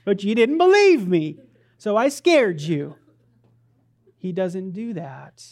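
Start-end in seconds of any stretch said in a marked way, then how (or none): 0.0-3.0 high, loud prankster's reveal; gleeful mock gotcha. Preacher as prankster Jesus teasing his disciples, loud gotcha. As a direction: crow it like the prank worked.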